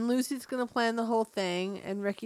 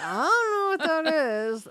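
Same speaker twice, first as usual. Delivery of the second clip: higher-pitched put-on innocent voice; mock cluelessness; 6 semitones higher, 4 dB louder than usual. Woman answering a question about Ouija, mock cluelessness. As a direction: play dumb, lightly hammed up.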